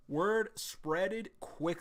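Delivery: very slow, exaggerated word spreaded quick; mock-reread it slowly; slow mock reading. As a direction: drag out the mangled phrase. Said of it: "mocking slow reread".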